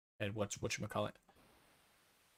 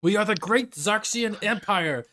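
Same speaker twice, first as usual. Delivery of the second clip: raised, loud haughty proclamation; indignant imperial elf; 7 semitones higher, 4 dB louder than usual. Acted. Astral Elf answering back for the great empire, indignant proclamation. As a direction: declare it high and loud, offended. Enemy elf's indignant retort, acted.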